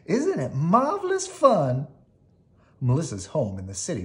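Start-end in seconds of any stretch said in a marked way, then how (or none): 0.0-1.9 high, loud gleeful squeal; thrilled to bits, squealing; excited. Acted. Melissa delighted with the fast ride, squealing.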